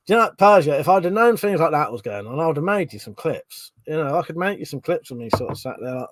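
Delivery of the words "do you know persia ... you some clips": ordinary talk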